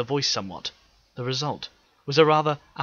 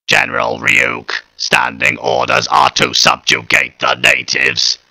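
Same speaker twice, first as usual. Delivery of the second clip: louder, slow harsh robotic report; Dalek voice; 2 semitones higher, 14 dB louder, slower than usual. Acted. Dalek answering General Ryuk, slow harsh robot voice. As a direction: state the orders mechanically.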